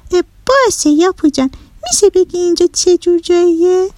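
put-on high-pitched child's voice, curious; conceding little girl. A narrator's reading. Girl asking Yapu about his island, curious. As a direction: give in and ask in a high girl's voice.

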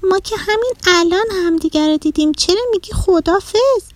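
put-on high-pitched child's voice, puzzled; puzzled little girl. A narrator's reading. Girl baffled by the boy's goodbye, puzzled. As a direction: question it in a high, baffled girl's voice.

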